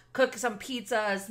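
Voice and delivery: normal talking voice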